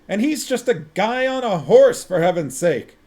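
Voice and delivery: loud high scream of frustration. A narrator's reading. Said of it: "screamed Vimes".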